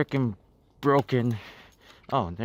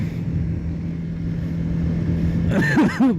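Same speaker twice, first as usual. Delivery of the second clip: high laugh. Real, not acted. Laughing at the puppy.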